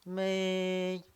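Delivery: "may" held high and level; long, steady, high "may"; first tone. Shown as a demonstration. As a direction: stretch "may" on one high pitch. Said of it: "first tone".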